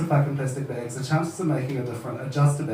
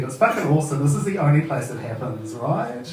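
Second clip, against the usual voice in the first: ordinary talk, nothing marked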